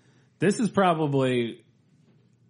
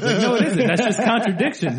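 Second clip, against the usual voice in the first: squeaky laughing mock argument, higher and louder; 10 semitones higher, 6 dB louder than usual. Retelling the argument sketch to friends, laughing higher and louder. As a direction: laugh through the retold quote.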